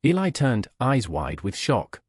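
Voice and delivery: ordinary delivery, nothing marked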